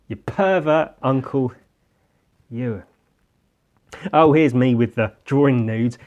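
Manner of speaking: ordinary talk, nothing marked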